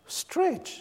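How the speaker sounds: high, soft, lightly voiced call; quiet, lifted imitation of a divine prompt; God's command, voiced. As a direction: gently call the word out high.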